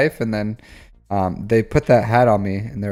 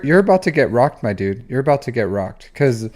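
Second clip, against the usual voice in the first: ordinary delivery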